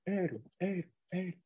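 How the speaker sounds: "eru" said as a soft, isolated syllable; drawn-out eru. Shown as a demonstration. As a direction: say "eru" softly, stretching the sound. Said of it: "el sonido eru".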